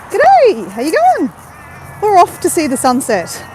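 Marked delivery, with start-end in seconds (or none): 0.1-1.3 high, loud call of g'day; bright greeting. Real, not acted. Greeting a passing stranger on the path, high cheery call. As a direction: sing out a cheery hello.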